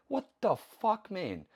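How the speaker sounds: high, slow, drawn-out "what the fuck"; ranting about coaches, high and incredulous; incredulous outrage. Real, not acted. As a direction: let the disbelief pitch up and drag.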